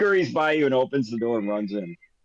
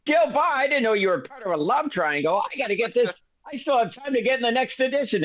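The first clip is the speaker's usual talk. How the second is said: lightly voiced reporter, higher-pitched; mock shock, eager for the scoop; excited reporter; 7 semitones higher than usual. Reporter stumbles on a scandal before deadline, mildly excited. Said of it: "And he's like".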